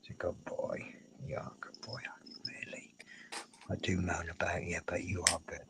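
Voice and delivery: soft, tender whisper to a pet; whispering to the cat. Real, not acted. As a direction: murmur praise into the kitten's ear.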